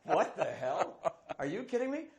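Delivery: slow, loud, laughing through it, rising to a high squeak; can't believe the praise, laughing; laughing disbelief. Retold or imitated. The actor thinking, stunned by the director's praise, laughing. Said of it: "And I'm thinking".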